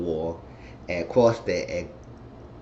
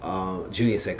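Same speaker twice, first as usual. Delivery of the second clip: ordinary talk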